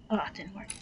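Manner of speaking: usual speaking voice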